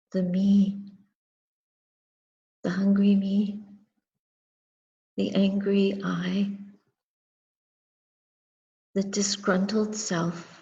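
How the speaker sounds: ordinary talk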